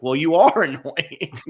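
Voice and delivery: mock scolding through a laugh, slow and loud; amused imitation of a blunt rebuke; laughing retort. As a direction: laugh while telling him off. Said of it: "He's like".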